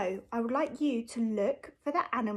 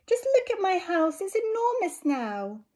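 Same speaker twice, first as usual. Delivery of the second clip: high, loud joyful exclamation; delighted old lady; 8 semitones higher, 4 dB louder than usual. Acted. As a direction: rejoice at the space. Lady delighted with big house.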